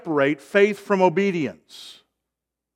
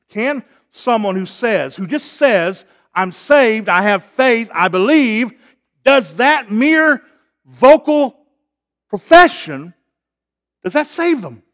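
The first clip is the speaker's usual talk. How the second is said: loud, high, rising challenge from the pulpit; impassioned preaching; 5 semitones higher, 9 dB louder than usual. Real, not acted. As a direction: build to a forceful rhetorical question. Preaches at full force.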